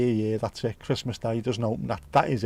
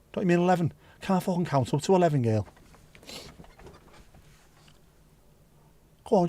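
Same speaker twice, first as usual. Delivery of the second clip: Scouse caller voice; 5 semitones higher than usual. Pretend. Acted exchange about sudden fried.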